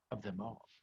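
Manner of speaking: ordinary delivery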